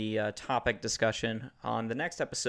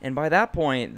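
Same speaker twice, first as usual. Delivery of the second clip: normal talking voice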